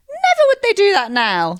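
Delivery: high and loud with indignation; emphatic. Real, not acted. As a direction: punch it out, exasperated. Emphatic complaint about being leered at.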